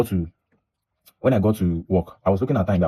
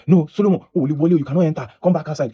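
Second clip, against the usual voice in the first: imitating the guard, much higher and louder; mock shout; 8 semitones higher, 4 dB louder than usual. Guard ordering the late worker back outside, mock shout. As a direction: bark the order like the angry guard. Guard blocks him at door.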